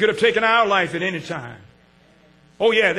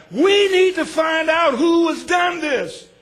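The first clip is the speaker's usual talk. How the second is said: loud, slow, higher demand from panicked sailors; sailors shouting; 8 semitones higher, 4 dB louder, slower than usual. Acted. Sailors on the storm-tossed ship demanding the culprit, loud. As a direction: call it out like scared mariners.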